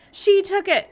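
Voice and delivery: high, loud accusing shout. Acted. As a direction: yell it at her, pointing. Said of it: "began shouting".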